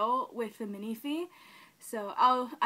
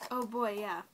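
normal talking voice

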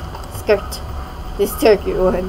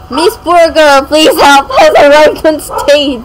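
laughing plea; 4 semitones higher, 8 dB louder, slower than usual. Real, not acted. Calls herself on stage, laughing.

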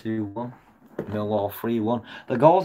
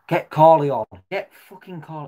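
frustrated loud demand; 5 semitones higher, 7 dB louder than usual. Real, not acted. Fan demanding substitution.

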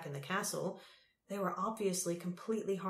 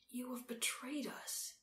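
soft, higher-pitched accusation; stunned accusation; 5 semitones higher, 9 dB quieter than usual. A narrator's reading. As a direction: accuse them almost under your breath. Tal's stunned quiet accusation.

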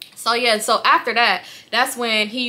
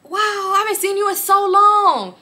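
much higher, hammed-up excited man; mimicking him gushing, higher; imitating the creep; 10 semitones higher, slower than usual. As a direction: squeal it like an overeager guy. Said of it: "He was like".

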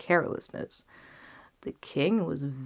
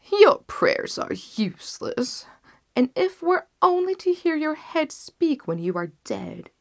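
ruthless king; 9 semitones higher, 6 dB louder than usual. Acted. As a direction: dismiss his plea with contempt. King refuses mercy.

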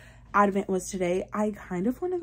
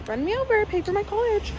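high joking voice; 12 semitones higher than usual. Pretend. Joking plea to car behind.

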